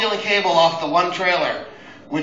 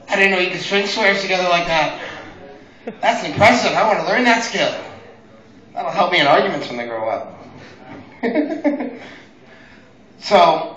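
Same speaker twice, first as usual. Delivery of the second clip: ordinary delivery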